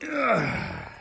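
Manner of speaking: high, loud falling groan; exasperated groan. Real, not acted. Handler urging a snake that won't move, exasperated groan.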